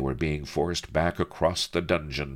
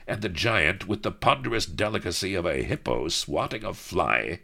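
ordinary delivery

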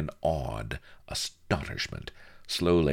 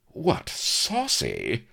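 high, slow, drawn-out affront; mock outrage; 8 semitones higher, much slower than usual. A narrator's reading. Mistress taken aback by his boldness, mock outrage. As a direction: stretch it out in haughty surprise.

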